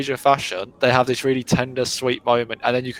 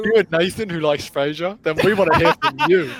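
high playful announcer voice; 5 semitones higher than usual. Pretend. Jokey call-out to listeners.

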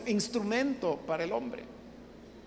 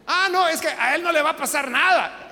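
higher, louder mocking parent voice; smug parent; 6 semitones higher, 7 dB louder than usual. Preacher mocking parent defending the son, smug. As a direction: play the smug double standard.

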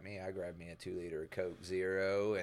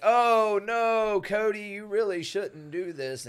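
high, loud, exaggerated pleading; mock protest; 11 semitones higher, 8 dB louder, slower than usual. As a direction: wail it as a fake attempt to intervene. Friend's mock protest in story.

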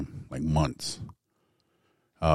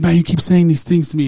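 higher, louder frightened plea; terrified husband confronting his wife; panicked pleading; 11 semitones higher, 11 dB louder than usual. Retold or imitated. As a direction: shake her and demand an answer in fear.

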